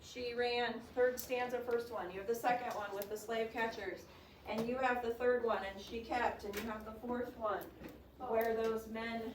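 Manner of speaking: ordinary delivery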